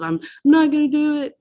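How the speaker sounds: high, pleading promise to quit; whiny empty promises. Retold or imitated. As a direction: beg like a relapsing drunk who means it each time. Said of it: "those empty promises of".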